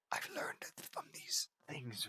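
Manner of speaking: put-on soft, slow whisper so the baby sleeps. Speaker whispering beside the sleeping baby, whisper. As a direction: breathe it out, barely voiced.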